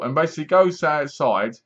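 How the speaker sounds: ordinary delivery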